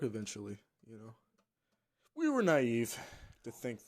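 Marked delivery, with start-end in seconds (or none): 2.1-3.0 loud. Real, not acted. Rueful loud admission.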